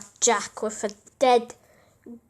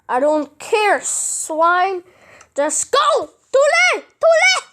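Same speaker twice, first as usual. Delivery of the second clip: high, louder, rising to a shout; defiant yell; 7 semitones higher, 7 dB louder than usual. Acted. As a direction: fling it back defiantly. Translated defiant retort.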